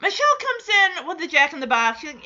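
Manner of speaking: ordinary talk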